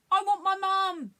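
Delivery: high, loud, drawn-out cry; panicked wail. A narrator's reading. Alien in a panic over the salt, wailing. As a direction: wail it slowly like a scared child. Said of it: "in a panic, shouting things".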